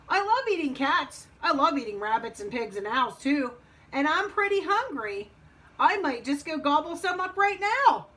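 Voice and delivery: hungry wolf. Acted. Wolf boasts to Frog of eating, relishing. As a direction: relish it, building to a high finish.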